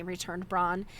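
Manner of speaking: ordinary delivery, nothing marked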